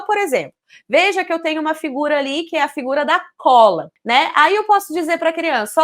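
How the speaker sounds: ordinary talk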